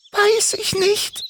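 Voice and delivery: sehr hohe, piepsige Vogelstimme; piepsig. Acted. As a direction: wie eine kleine kranke Drossel piepsen. Drossel Doris spricht. (Drossel Doris antwortet Timmy, hoch piepsend)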